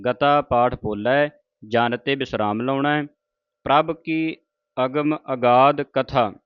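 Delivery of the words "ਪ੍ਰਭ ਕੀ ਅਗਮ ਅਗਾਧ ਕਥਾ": ਪ੍ਰਭ ਕੀ, a pause, then slow ਅਗਮ ਅਗਾਧ ਕਥਾ; pause after ਕੀ. Shown as a demonstration. Teacher demonstrates the pause in recitation. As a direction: break the line after ਕੀ. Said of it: "ਇੱਥੇ ਜਿਹੜਾ ਵਿਸਰਾਮ ਪੰਕਤੀ ਦਾ ਆਇਆ".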